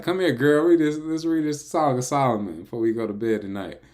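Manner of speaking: high, loud playful invitation to his wife; playful flirty call. Pretend. Imitates inviting his future wife.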